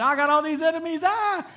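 high, fast panicked scream. Retold or imitated. Preacher imitating David crying to God in verse one, hammed-up screaming. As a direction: scream it out, climbing to a wild "ah!". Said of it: "he was screaming at God".

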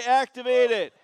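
shouted "Activated" to lead the crowd; loud group call cue. Shown as a demonstration. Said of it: "look at the camera and say".

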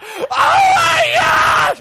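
very high, loud, long-drawn shriek; the tough guy secretly screaming inside; hammed-up panicked wail; mock terrified scream. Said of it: "He's like".

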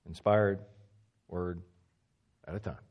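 "one inspired word" split by long pauses, dropping low and soft; slowed down. Shown as a demonstration. Teacher showing how it slows him down, word by word. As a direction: let each word stand alone, fading away. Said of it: "This slows me down to look at one".

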